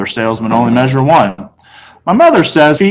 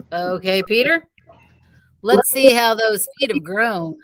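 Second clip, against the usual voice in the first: higher, soft, slow and kindly; salesman voice; 7 semitones higher, 7 dB quieter than usual. A narrator's reading. Salesman coaxing Peter to try shoes, soft and slow. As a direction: coax the boy gently.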